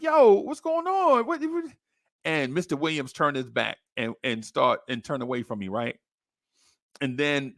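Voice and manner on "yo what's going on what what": a lightly voiced kid, high and slow; drawn-out pitched-up boy's question; kid asking what happened. Boy asking teacher what's going on, lightly voiced kid.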